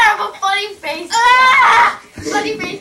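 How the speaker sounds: loud, silly yelling about a funny face; silly shout. Real, not acted. Kid yelling at the camera while making a funny face, loud. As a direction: shout it goofily.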